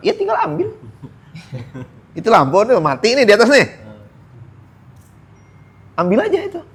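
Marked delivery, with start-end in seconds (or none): 0.1-0.7 high, squeaky brush-off; glib dismissive reply. Retold or imitated. Mock helper telling Sat to just take the lamp, squeaky brush-off. 6.0-6.6 high, squeaky brush-off; glib dismissive reply. Retold or imitated. Mock helper telling Sat to just take the lamp, squeaky brush-off.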